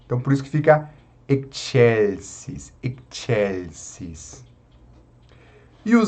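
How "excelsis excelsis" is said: "excelsis" as "ek-chel-sis"; K plus tch. Shown as a demonstration. Teacher showing the joined sounds, "excelsis" as "ek-chelsis". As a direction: slowly join K and CH in "excelsis". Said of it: "une o som do X e o som do C".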